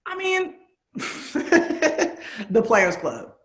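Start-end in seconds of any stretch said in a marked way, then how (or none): 1.0-2.5 breathy embarrassed laugh. Real, not acted. Embarrassed guilty pleasure admission.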